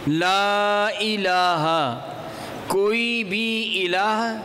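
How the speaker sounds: لا الہ chanted slowly with the لا held; recited long. Shown as a demonstration. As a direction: draw out لا as in recitation. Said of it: "لام کو چھوٹا رکھیں". (ترتیل کے انداز میں لمبا)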